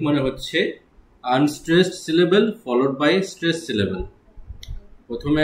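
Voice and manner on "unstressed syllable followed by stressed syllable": each word of the definition drawn out; slow dictation. Shown as a demonstration. Teacher to exam candidates, very slow dictation. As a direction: give the definition slowly for notes.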